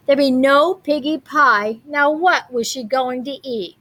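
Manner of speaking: ordinary delivery, nothing marked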